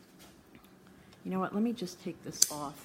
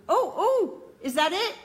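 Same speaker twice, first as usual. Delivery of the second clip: a high, loud hopeful yelp; spotting that the fix may have worked; sudden hopeful surprise; 10 semitones higher, 6 dB louder than usual. Real, not acted. Streamer thinks camera is finally fixed, high surprised yelp.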